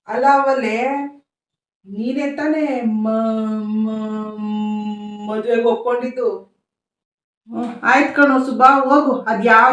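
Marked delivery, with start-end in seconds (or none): 1.9-6.3 soft repeated broken starts before the name; stammering son straining to call his wife; stuttering son. Acted. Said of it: "ನೀನ್ ನೋಡಿದ್ರೆ ತೊದ್ಲ".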